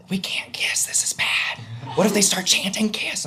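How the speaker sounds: urgent whispered worry; panicked whisper. Retold or imitated. She warns him about kissing, panicked whisper. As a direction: imitate her whispering in alarm. She worries before the crowd.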